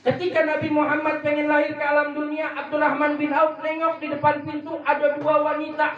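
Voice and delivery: high, ringing preacher's narration; rousing sermon storytelling. Acted. Preacher telling congregation of women descending from heaven, rousing. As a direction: build suspense toward a miracle.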